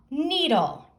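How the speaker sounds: usual speaking voice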